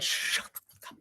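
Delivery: voiceless, whispered telling-off; hushed mock outrage, all whisper; whispered curse. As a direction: swear under your breath in a whisper. Retold reaction to the prank.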